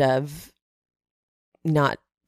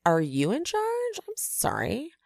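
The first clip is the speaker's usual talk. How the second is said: high, rising questions in mock disbelief; softly incredulous, voice lifting high; mock incredulous challenge; 8 semitones higher, 5 dB quieter than usual. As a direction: act out an affronted challenge, pitched way up. Voicing Jen challenging who is in charge.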